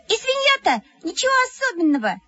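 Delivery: very high, fast squeak of envy; squeaky. A narrator's reading. Mukhina squeaks her jealous dismissal. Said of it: "пропищала Мухина".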